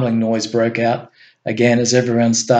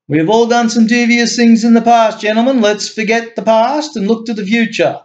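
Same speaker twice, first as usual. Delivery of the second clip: a touch of a grand, louder speech; speechmaking; 9 semitones higher, 3 dB louder than usual. A narrator's reading. Osama addressing the gentlemen, a touch of speechmaking. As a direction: lift it into a statesman's address. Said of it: "a speech fit for a candidate".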